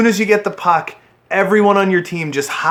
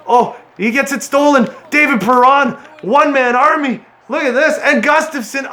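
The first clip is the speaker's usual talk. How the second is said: much higher, fired-up play call; cheering his player's solo rush; high, thrilled commentator voice; excited; 9 semitones higher than usual. Real, not acted.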